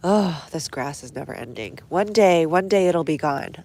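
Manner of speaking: ordinary talk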